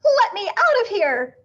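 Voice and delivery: high, loud panicked shriek; panicked shriek to escape. A narrator's reading. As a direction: shriek to be let out. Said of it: "Missus Hipple shrieked".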